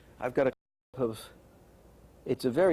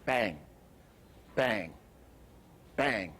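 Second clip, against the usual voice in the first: bang as one short, abrupt hit, then silence; hard accent; 2 semitones lower, much slower than usual. Shown as a demonstration. Animation teacher demonstrating a hard accent, hard separate hits. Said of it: "the hard accent is this".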